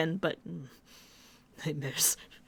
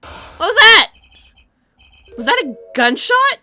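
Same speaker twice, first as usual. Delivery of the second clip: high alarmed yelp; startled; 13 semitones higher, 12 dB louder than usual. Acted. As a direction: jump at the bang. Startled by gunshot sound.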